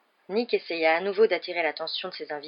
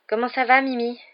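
higher, put-on cheery greeting; falsely cheerful; 5 semitones higher, 4 dB louder than usual. A narrator's reading. Girl greeting a ghost she dislikes, falsely cheerful. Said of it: "d'un ton faussement enjoué".

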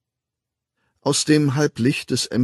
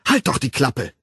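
snapping; 2 semitones higher, 4 dB louder than usual. A narrator's reading. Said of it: "herrschte Lenina ihn an".

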